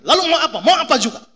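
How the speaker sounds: loud, high retold comeback; defiant challenge. Rider and driver squaring off on the road, loud defiance. Retold roadside quarrel, answering back.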